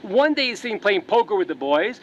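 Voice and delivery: ordinary talk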